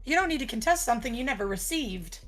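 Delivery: ordinary delivery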